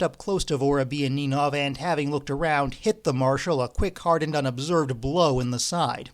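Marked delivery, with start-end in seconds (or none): none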